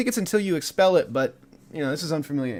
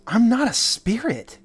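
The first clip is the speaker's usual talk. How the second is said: high, slow, swooping protest; indignant orb; 7 semitones higher, 4 dB louder, slower than usual. Acted. GM as the orb rejecting the skull offer, indignant. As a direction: draw out the denial with affronted emphasis. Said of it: "He goes".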